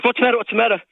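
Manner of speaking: alarmed. Retold or imitated. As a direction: rush it out like a startled wife.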